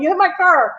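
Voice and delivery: high mock shout of an annoyed driver; retold yelling. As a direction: imitate the guy yelling at you. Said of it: "He's, like, yelling at me".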